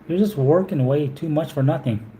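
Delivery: normal talking voice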